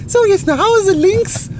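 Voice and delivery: put-on high, loud child voice; little boy voice. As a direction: chirp it like a happy little kid. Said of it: "dann sich denkt so".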